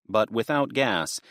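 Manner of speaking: plain reading voice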